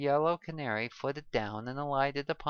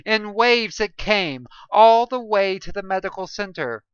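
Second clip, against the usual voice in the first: pained howling narration; 7 semitones higher, 14 dB louder than usual. Acted. Narrator in searing pain.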